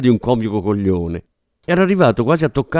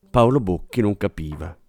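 usual speaking voice

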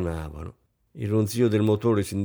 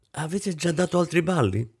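higher-pitched question through pursed lips; 7 semitones higher than usual. A narrator's reading. Isabel asks her hostess about past balls, lips pursed. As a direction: ask it politely with lips pursed. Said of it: "stringendo le labbra".

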